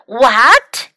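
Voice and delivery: loud storybook exclamation; mildly acted disbelief, with extra volume; shocked cry. A narrator's reading. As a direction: cry out in surprise as a narrator would. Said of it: "cried Carmen".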